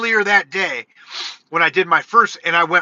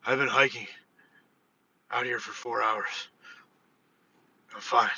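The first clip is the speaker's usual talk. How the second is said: exhausted reply; 7 semitones lower, 12 dB quieter than usual. Retold or imitated. Exhausted reply to the worried lady.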